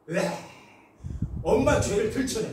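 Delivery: ordinary talk, nothing marked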